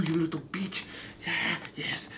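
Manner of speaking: normal talking voice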